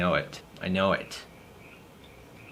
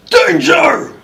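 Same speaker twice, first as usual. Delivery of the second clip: "Danger!" screamed much higher and louder; shouted danger; 11 dB louder than usual. Acted. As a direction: belt out "Danger!" at full force.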